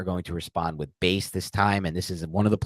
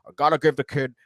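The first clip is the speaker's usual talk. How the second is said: higher, mock-earnest GM defending his quarterback; Dave Gettleman impression; 6 semitones higher than usual. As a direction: play the stubborn general manager pleading for his kid QB. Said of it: "Your Dave Gettleman".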